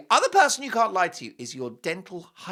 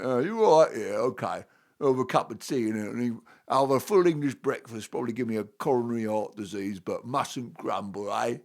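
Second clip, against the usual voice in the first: grumbling English bloke; 4 semitones lower than usual. Retold or imitated. Host mocking the English who mustn't grumble, broad English accent. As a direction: play a weary English moaner in a broad English accent. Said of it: "And in England, it's like".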